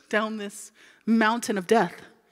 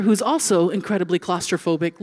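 ordinary delivery

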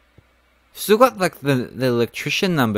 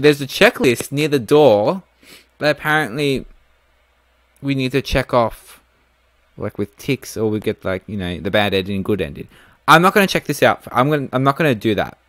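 ordinary delivery

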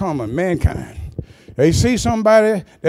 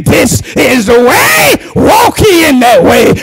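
slow, loud, half-sung call; a lightly voiced booming divine voice; voice of God; 6 semitones higher, 13 dB louder than usual. God's voice calling from behind, slow and loud. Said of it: "a voice behind you saying".

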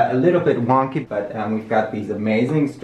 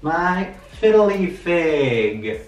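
slow, far-off little plant voice; plant character voice; 5 semitones higher, much slower than usual. Pretend. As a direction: give the plant a slow cute voice.

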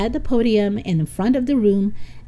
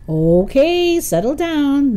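raised, higher teacher's call; 7 semitones higher, 4 dB louder, slower than usual. Acted. Mister Simmons quiets the class, calling out. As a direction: call the noisy room to order. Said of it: "Mister Simmons called out".

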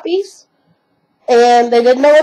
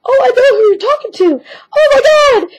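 very high, excited pup voice with a laugh; dog's voice; 12 semitones higher than usual. Pretend. The puppy answering her new name, squeaky and excited. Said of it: "And Peanuts was like".

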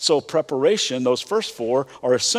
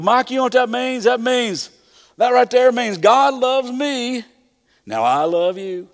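put-on hicky Tennessee accent; 10 semitones higher, 5 dB louder than usual. Retelling his camp preacher friend Tommy explaining to kids, Tennessee accent. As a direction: imitate a Tennessee preacher's twang. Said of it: "he's got a real hicky accent".

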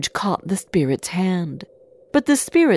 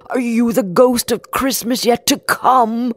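higher, anxious questioning; scared Scrooge; 5 semitones higher, 4 dB louder than usual. A narrator's reading. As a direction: ask the ghost in acted fear. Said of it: "Scrooge was really scared".

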